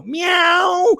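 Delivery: high, stretched-out meow; jokey high cat cry; mock cat meow. Sounded like a dead cat.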